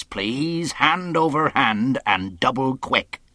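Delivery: stern defiant captain. Acted. Captain refuses Silver's terms, in stern commanding voice. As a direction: lay down the law to a mutineer.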